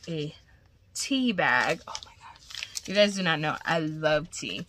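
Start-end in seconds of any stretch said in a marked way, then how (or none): 1.9-2.9 gasped whisper. Real, not acted. Excited over tea bag.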